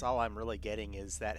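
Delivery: normal talking voice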